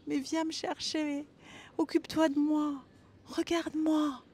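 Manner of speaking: a hint of a needy little girl; little girl pleading. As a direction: plead gently like a small child. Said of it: "cette petite fille qui me dit".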